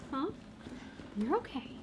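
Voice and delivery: ordinary delivery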